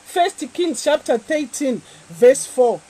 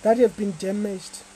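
prayer; 4 semitones lower, 6 dB quieter than usual. Acted. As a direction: pray gently over them. Quiet prayer over the water.

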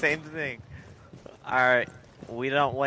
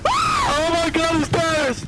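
high, slow mocking squeal; mocking impression; 6 dB louder, slower than usual. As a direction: mimic a panicked friend. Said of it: "He says".

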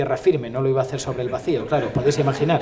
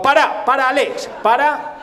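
high, loud shout to stop everything; frantic halt; 10 semitones higher, 6 dB louder than usual. Acted. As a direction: call urgently to the director to halt. Halting the stunt in panic. (grito agudo para detenerlo todo)

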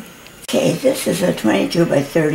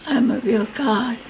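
whispering; 6 semitones higher, 5 dB quieter than usual. Retold or imitated. Whispered retelling of vision.

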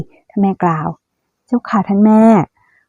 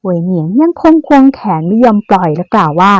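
plain reading voice

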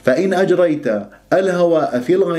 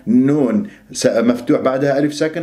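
usual speaking voice

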